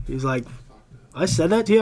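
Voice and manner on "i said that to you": bewildered. Retold or imitated. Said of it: "was bewildered".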